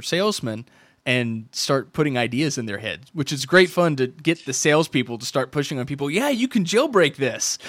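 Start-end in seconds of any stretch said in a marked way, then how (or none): 6.1-7.6 high, slow salesperson voice; mock salesperson. Salesperson now pushing jailbreaking, mock eager pitch. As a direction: sell it like a converted clerk.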